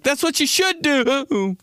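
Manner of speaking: high, slow mock yell breaking up; voice cracking. Comedian demonstrates his voice cracking when yelling, high and breaking. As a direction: crack into a tearful high wail. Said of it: "My voice always cracks".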